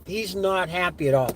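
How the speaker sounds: ordinary delivery, nothing marked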